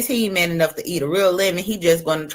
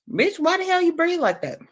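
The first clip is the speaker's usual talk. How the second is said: incredulous mock; 9 semitones higher than usual. Vlogger mocking rival's breathing, high incredulous. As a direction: raise the pitch in mock disbelief.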